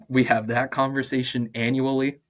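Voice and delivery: ordinary talk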